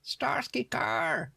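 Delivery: very high, slow, drawn-out Starsky Carr; summoning call. Pretend. Friends on the stream calling Starsky Carr in a ghostly wail. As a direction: sing out the name like a ghostly summons.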